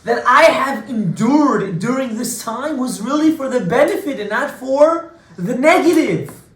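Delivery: high, loud and slow, swelling; preaching at full force. Acted. Preacher proclaiming Yosef's answer to the brothers, booming. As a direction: preach it out to the room. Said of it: "preaching climax, loud high".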